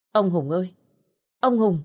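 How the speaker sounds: loud worried call across the room; calling loudly. A narrator's reading. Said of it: "gọi lớn".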